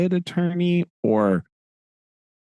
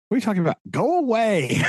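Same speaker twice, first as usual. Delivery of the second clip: dismissive lawyer; 8 semitones higher, faster than usual. Retold or imitated. Jaggers dismisses the man.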